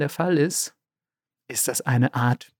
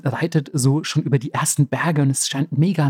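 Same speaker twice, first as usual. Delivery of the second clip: ordinary delivery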